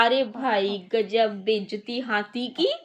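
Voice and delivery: usual speaking voice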